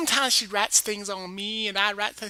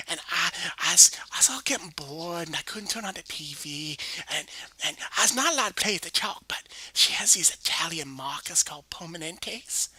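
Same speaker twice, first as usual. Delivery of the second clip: ordinary delivery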